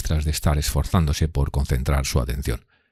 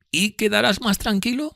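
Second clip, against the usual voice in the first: very high lady's voice; concerned Miss Havisham. Acted. Miss Havisham asking after Pip's peace, high voice. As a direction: ask with concern, pitched well up.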